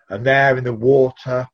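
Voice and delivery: ordinary delivery, nothing marked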